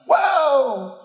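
high calling whoa to runaway horses; hollering whoa. Retold or imitated. To the runaway horses, a high hollered whoa. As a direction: yell it high to stop the horses. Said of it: "I was hollering".

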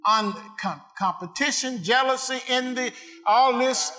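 loud, rising sermon cadence; preaching at full force. Acted. Pastor preaching to his congregation, full force. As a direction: preach it out to the church, building.

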